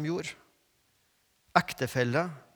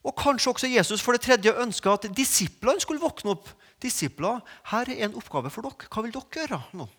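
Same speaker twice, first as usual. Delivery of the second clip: rising, louder preaching voice; 7 semitones higher, 4 dB louder than usual. Acted. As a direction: lift the congregation, higher and stronger. Preacher building to voiced Jesus.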